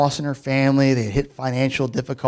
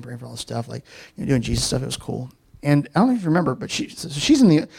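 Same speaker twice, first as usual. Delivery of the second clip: usual speaking voice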